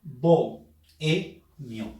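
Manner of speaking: very slow, split into syllables: "Bohemio"; slow dictation of "Bohemio" for the class. Shown as a demonstration. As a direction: stretch "Bohemio" out as bo-he-mio. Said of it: "Se silabea así".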